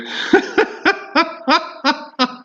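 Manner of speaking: giggling high up; high laugh. Real, not acted. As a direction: burst out giggling. Audio trouble amusement.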